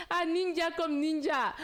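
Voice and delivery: high, lightly voiced playful friend; imitating Diallo. Retelling Diallo's joke in the wings, high playful imitation. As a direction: mimic a teasing friend backstage. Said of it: "il y a Diallo qui dit".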